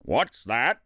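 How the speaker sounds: low, sharp royal question; mildly irritated king's snap; snapped. A narrator's reading. Yertle hears a faint sigh below, snapping. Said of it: "snapped the king".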